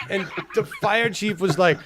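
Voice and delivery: ordinary delivery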